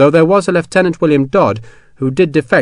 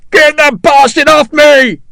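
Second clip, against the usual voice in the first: much higher, louder, slow screaming plea; terrified scream; 8 dB louder, slower than usual. Acted. Hexwell screaming as the elephant closes in. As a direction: scream in terror at a raised foot. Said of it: "Hexwell screamed".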